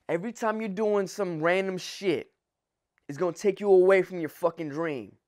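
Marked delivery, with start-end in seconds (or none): none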